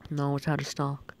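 normal talking voice

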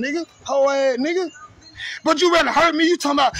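ordinary talk

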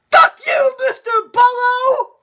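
high, loud joking shout; hammed-up loud cursing sign-off; mock yell. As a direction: yell it out for laughs. Yelled sign-off insults.

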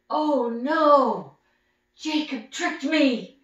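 loud, high, slow dismay; dismayed cry. Acted. Father realizing Jacob tricked him, loud high lament. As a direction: wail it like a cheated old man.

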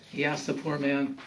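usual speaking voice

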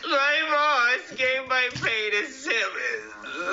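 slow, high-pitched blubber; wailing. Acted. Officer repeats his pay complaint to buddy, bawling. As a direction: drag the words out in a high wail.

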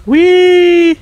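loud, high thrilled squeal; gleeful whee. Real, not acted. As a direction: squeal like on a ride. Riding something in game.

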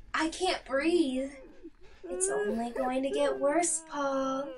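ordinary talk

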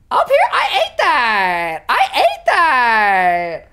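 loud, slow, wailed brag; triumphant boast. Pretend. Player proud of the writing just done, drawn-out loud boast. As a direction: stretch the last words way out, showing off.

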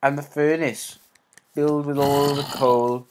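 ordinary delivery

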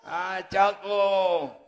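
slow, loud call to Chapo; long hollered name; drawn-out shout. Real, not acted. Calling out to Chapo.

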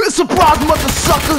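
loud, high ambush yell; spring the surprise and yell it; gleeful shout. Real, not acted. Jumping an enemy in the game, a gleeful loud yell.

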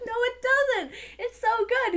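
high, loud retold protest; arguing back. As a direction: re-enact a loud, high-pitched objection. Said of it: "we had a big argument".

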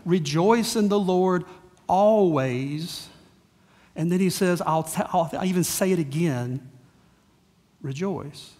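usual speaking voice

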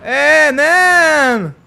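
high, loud drawn-out hail; exuberant calling out. Real, not acted. Gamer to an unhearing English player, hollered greeting.

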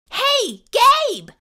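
loud, high, excited call; cheered. A narrator's reading. Child greeting monster friend, high loud cheer. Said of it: "I cheered".

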